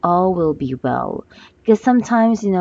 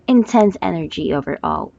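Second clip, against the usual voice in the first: normal talking voice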